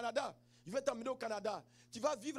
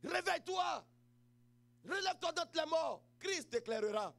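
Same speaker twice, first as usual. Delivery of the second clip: preaching call; 4 semitones higher than usual. Acted. Opening call to the congregation.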